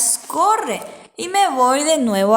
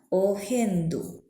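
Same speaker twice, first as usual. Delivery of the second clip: soft word demo; 5 semitones lower, 6 dB quieter than usual. Shown as a demonstration.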